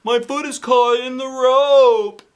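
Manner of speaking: high, loud, slow panicked call; panicked cry. Acted. Principal stuck upside down calls out in panic. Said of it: "he shouted".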